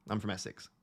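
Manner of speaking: I'm from Essex in broad Essex; quiet, broad Essex delivery; Essex accent. Shown as a demonstration. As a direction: drop into her natural Essex. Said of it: "very Essexy accent normally".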